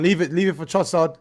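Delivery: urgent shout to leave it; calling out. Real, not acted. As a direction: call it out to the pitch. Calling out to the player.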